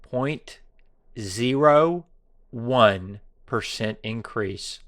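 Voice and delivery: slow, word by word with pauses; Point, zero, one percent increase, each word apart and slow. Shown as a demonstration. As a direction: spell it out slowly so it sinks in. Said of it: "How can I say it slower so it sinks in?".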